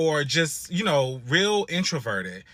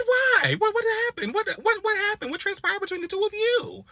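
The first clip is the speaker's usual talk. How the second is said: much higher, alarmed imitated questions; shocked questioning. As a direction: demand to know what happened, startled. Retelling his alarmed questioning.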